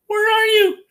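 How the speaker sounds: put-on a small girl calling into an empty room; Dorothy asking. A narrator's reading. Dorothy calling to the unseen wizard, high and childlike. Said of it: "Dorothy asked".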